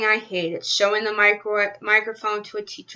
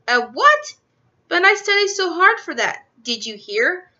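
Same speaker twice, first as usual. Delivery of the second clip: high, louder shocked disbelief; shocked protest; 7 semitones higher, 3 dB louder than usual. Acted. Girl shocked at a zero.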